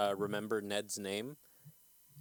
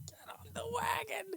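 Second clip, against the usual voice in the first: high whispered hurry; whispered urgent order; 4 dB quieter than usual. Retold or imitated. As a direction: hiss it urgently, high and breathy. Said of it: "you're like".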